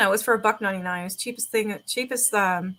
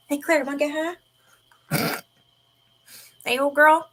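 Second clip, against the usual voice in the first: higher, teasing call to the cat; playful pet voice; 6 semitones higher, 4 dB louder than usual. Real, not acted.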